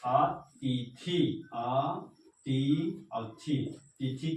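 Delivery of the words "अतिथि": अतिथि said very slowly, syllable by syllable; slow dictation. Shown as a demonstration. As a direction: stretch अतिथि out for the class to write. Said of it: "लिखेंगे अतिथि". (धीरे-धीरे बोलकर लिखवाना)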